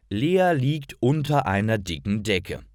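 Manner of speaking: ordinary talk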